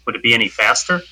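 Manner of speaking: normal talking voice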